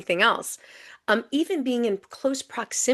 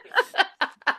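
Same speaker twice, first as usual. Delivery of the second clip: high bright laugh; 12 semitones higher than usual. Real, not acted. Laughs at the host's joke.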